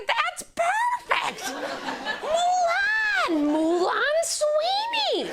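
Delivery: high, loud delighted squeal; mother overjoyed, crying the name out; thrilled mother. Retold or imitated.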